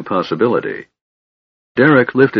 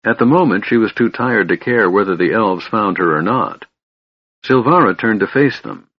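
usual speaking voice

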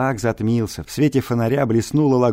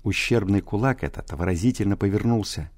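usual speaking voice

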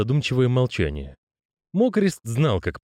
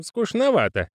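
curious postman; 14 semitones higher than usual. Acted. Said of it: "спросил Грош".

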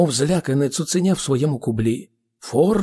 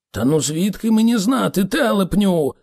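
raised, higher-pitched retort; exasperated cry; 6 semitones higher than usual. A narrator's reading. Ford snaps at Arthur's silly question, crying out. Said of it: "крикнув Форд".

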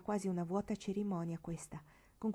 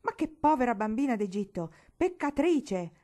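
loud scornful outburst, rising higher; Uncle Jarvis, thundering; 5 semitones higher, 8 dB louder than usual. A narrator's reading. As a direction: boom out the condemnation. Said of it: "tuonò di bel nuovo lo zio Jarvis". (tuonando con sdegno)

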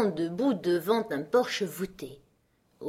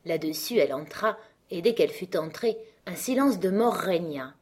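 plain reading voice

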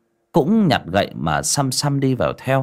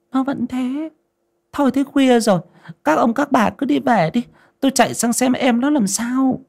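put-on high-pitched old woman's voice; concerned elderly woman, pitched well up; worried old wife. A narrator's reading. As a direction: send the neighbours home in a high voice.

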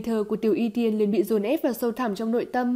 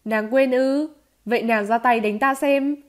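louder, challenging taunt; cold sneer; 3 semitones higher, 5 dB louder than usual. Acted. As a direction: dare her with a raised, scornful voice. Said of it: "Tiêu Viêm cũng cười lạnh". (cười lạnh, thách thức)

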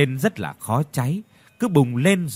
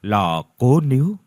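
ordinary delivery, nothing marked

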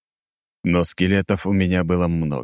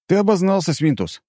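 disguised voice; 12 semitones higher than usual. Acted. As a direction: fake a stranger's voice. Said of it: "изменённым голосом ответил я".

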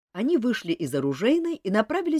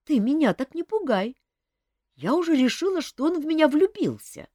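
put-on higher young woman explaining earnestly; young woman Olga; 11 semitones higher, 4 dB louder than usual. A narrator's reading. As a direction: voice the girl, higher and sincere. Olga relieved, teasing.

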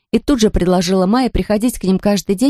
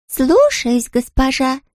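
obedient servant reply; 7 semitones higher, much slower than usual. Acted. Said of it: "повторила Лотта".

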